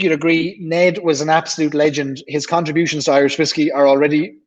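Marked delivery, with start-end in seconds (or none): 3.4-4.4 talking through a burp; a burp catches the words. Real, not acted. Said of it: "excuse me, I'm burping now".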